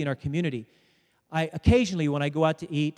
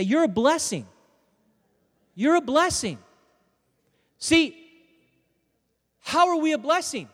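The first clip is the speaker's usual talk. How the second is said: high, projected preacher's voice; impassioned preaching; 8 semitones higher, 5 dB louder than usual. Real, not acted. Preacher telling the congregation they are a blessing, impassioned. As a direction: drive the point home to a congregation.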